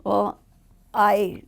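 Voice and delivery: usual speaking voice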